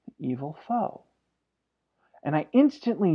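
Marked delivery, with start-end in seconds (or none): 0.0-1.0 soft, imitated little-boy voice; small little voice. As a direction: say it tiny and quiet, like an upset child. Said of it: "in this small little voice".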